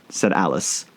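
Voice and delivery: plain reading voice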